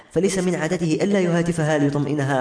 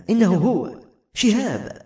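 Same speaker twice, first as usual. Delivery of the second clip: higher, slow cry of recognition; astonished exclamation; 6 semitones higher, slower than usual. A narrator's reading. Grandson recognising the writer's photo, astonished. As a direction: exclaim in wonder, drawing out the words. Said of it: "وصاح متعجبا".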